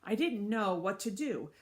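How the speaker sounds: ordinary delivery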